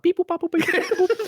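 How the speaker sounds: high beeping robot noises; space beeps. Retold or imitated. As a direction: chirp it like a space signal. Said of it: "stereotypical, like, space sound".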